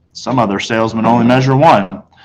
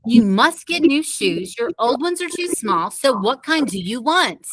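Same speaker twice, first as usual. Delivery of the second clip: higher, soft, slow reasoning; patient mother; 8 semitones higher, 5 dB quieter, slower than usual. A narrator's reading. Mother reasoning with Fudge, soft and slow. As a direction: reason calmly with the toddler. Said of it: "my mother said".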